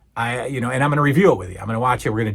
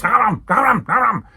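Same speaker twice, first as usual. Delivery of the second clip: high mock gobbling noises; angry chomping; 8 semitones higher, 4 dB louder than usual. Mister T angrily biting a banana, exaggerated munching. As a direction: chomp out each bite in fierce mock bursts. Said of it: "he's angrily eating the banana".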